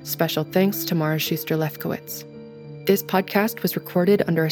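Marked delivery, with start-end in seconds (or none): none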